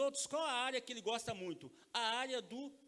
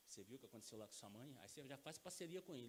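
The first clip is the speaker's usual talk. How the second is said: bem baixinho e grave, em ritmo ligeiro; abordagem mansa de pai; 10 semitones lower, 15 dB quieter, faster than usual. Acted. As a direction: puxe conversa de mansinho, em voz baixa. Pai aborda filho com jeitinho. (conversa mansa de pai para filho)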